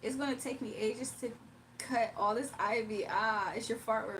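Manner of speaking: ordinary delivery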